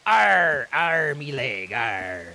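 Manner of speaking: loud growling pirate, slow. Shown as a demonstration. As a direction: belt out a hammy pirate groan. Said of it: "I feel like you missed opportunity".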